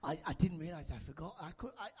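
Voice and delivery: ordinary talk, nothing marked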